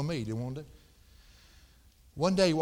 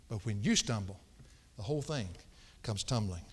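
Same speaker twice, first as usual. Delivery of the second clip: ordinary talk, nothing marked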